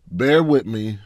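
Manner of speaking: ordinary delivery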